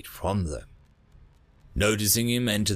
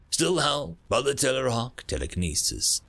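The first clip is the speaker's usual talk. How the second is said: plain reading voice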